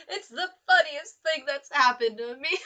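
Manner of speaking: talking through giggles; giggling elephant. Acted. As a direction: laugh while saying it. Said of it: "He began to giggle".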